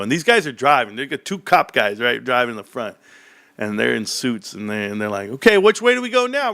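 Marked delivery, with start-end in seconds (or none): 5.3-6.5 cop voice. Retold or imitated. Cops asking directions.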